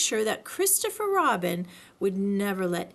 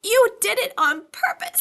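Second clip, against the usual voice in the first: loud high spluttered stammer; spluttering Piglet accusing Kanga; 11 semitones higher, 8 dB louder than usual. A narrator's reading. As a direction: splutter through soap. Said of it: "spluttered Piglet".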